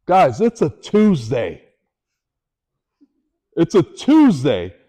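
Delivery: slow and loud, hammering it home; emphatic disbelief. Real, not acted. Speaker to audience, slow loud disbelief. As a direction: make them feel how ordinary the day was.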